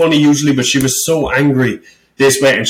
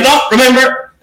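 mock stern host; 12 semitones higher, 4 dB louder than usual. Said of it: "She was, like, stern".

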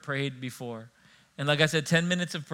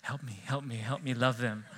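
softer, hushed plea to God; quiet prayer; 4 semitones lower, 7 dB quieter than usual. Retold or imitated. Pastor praying quietly amid a feud, hushed. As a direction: pray under your breath for patience. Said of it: "I was like".

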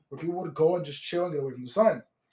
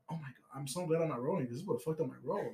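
quiet relief; 3 semitones lower, 10 dB quieter than usual. Retold or imitated. Retold inner thought while tripping.

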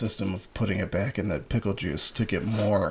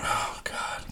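pained whisper; 8 dB quieter than usual. Real, not acted. Mouth burning from hot pickle.

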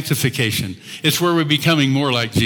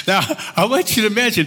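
ordinary talk

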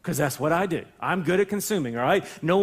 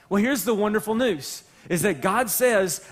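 ordinary talk, nothing marked

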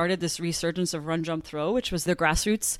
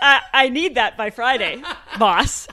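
laughing mock order; 6 semitones higher, 8 dB louder than usual. Jokey quote of a demand.

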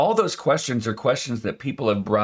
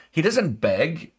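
ordinary delivery

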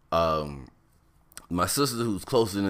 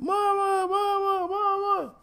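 screaming for mama; slower than usual. Retold or imitated. Boy racing through field calls mother, high mock scream. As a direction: repeat the cry in a high squeal at everyday volume. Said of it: "I'm running and I'm screaming".

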